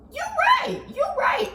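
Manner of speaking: high, laughing hype; excited agreement. Real, not acted. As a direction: lose it agreeing.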